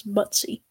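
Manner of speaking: "Butsy" in two syllables, ending in ee; looked-up pronunciation. Shown as a demonstration. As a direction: say "Butsy" as BUT-see. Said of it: "That's how it's supposed to be pronounced".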